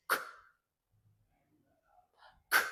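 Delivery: "K" as a bare voiceless consonant, not the letter name; phonics-style "K" with no vowel; K sound demo. Shown as a demonstration. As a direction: release a lone "K" sound, pause, repeat. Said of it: "The second one".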